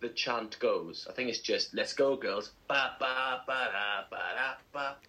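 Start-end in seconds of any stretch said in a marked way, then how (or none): none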